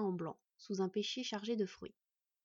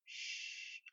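soft, soothing shush; murmured hush; 9 dB quieter than usual. A narrator's reading. Mother hushing her upset son, murmured. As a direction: hush a child gently. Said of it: "lui murmura-t-elle".

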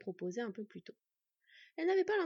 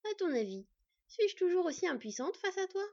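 rising, higher, louder challenge; provocative taunt; 8 semitones higher, 5 dB louder than usual. A narrator's reading. Empowered fox girl taunts the thief, higher and louder. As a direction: throw the taunt up at him. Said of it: "gronda-t-elle avec provocation".

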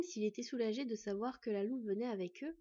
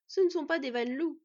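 desperate argument; 5 semitones higher, 8 dB louder than usual. A narrator's reading. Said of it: "en désespoir de cause".

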